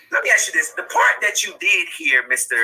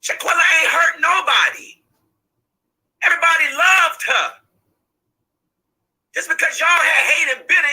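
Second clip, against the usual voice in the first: anguished shout; 7 semitones higher than usual. Real, not acted. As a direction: shout it out in grief. Mother defends her murdered daughter.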